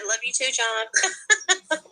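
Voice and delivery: slow, drawn-out mock sweetness; teasing imitation. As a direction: tease her by repeating it. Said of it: "She said".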